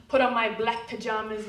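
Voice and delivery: ordinary delivery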